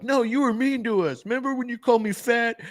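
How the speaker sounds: high-pitched whiny gang member voice; mock hurt complaint. Old gang answers the leader, mock hurt complaint. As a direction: complain in a high, hammed-up wounded voice.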